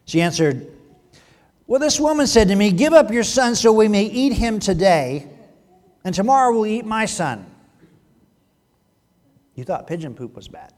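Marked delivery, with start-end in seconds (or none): 0.0-7.5 loud, higher-pitched dramatic Bible reading; forceful, raised reading voice; scripture reading. A narrator's reading. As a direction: project the woman's account to the congregation.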